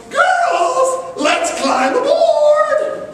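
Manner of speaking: rally the crew to board; pirate captain's yell. Acted. Woman pirate captain yelling to her crew, high slow battle cry. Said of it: "a woman pirate captain yelled".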